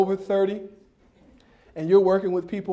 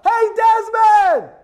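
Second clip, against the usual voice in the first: high, drawn-out hollering praise; big excited coach shout, stretched long; yelled out; 13 semitones higher, 6 dB louder, slower than usual. Retold or imitated. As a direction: holler across the field to a running kid. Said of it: "And I yelled out".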